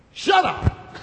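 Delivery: high, loud yell imitating mother; acted sudden shout. Mother silencing her singing son, imitated high shout. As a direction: cut in with a high-pitched shout.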